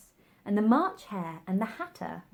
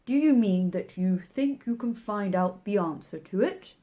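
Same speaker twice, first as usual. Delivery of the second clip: March Hare voice; 6 dB louder than usual. A narrator's reading.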